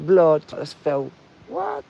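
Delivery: shocked exclamation. Real, not acted. Shocked at broken fence.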